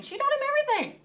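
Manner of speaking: quick, high exasperated aside; high-pitched exasperated rush. A narrator's reading. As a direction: blurt it out, fed up. Sal exasperated aside, voiced.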